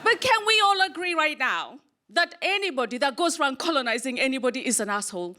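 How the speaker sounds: usual speaking voice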